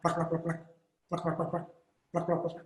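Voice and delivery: quiet steady plak plak plak; hushed mouthed flapping; soft wing flaps. Shown as a demonstration. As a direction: beat out the wings in a soft patter.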